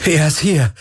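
high, elated cry; jubilant. A narrator's reading. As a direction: cheer the good news. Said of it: "jubelte Galen". (hoch und jubelnd)

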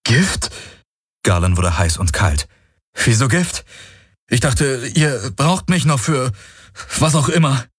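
shocked at the word poison; alarmed. A narrator's reading. (erschrocken, höher, fragend)

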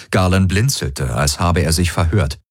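plain reading voice

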